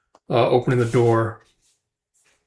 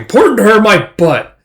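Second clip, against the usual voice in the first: raised, louder mock outburst; indignant scoff; 8 semitones higher, 7 dB louder than usual. Brother rejecting his coworker's advice, indignant scoff. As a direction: scoff it loudly as the offended brother.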